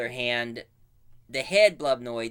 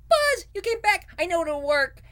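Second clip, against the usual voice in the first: very high excited sidekick; squeaky robot Slide; 5 dB louder than usual. Retold or imitated. Slide seeing Buzz return, squeaky high joy. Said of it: "going like".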